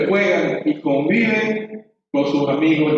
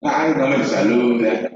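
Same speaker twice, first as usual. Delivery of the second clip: usual speaking voice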